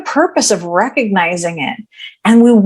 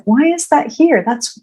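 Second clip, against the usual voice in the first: higher-pitched, wondering self-talk; intrigued, thinking aloud; curious; 7 semitones higher than usual. Acted. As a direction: puzzle it over with lifted curiosity. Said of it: "I get curious".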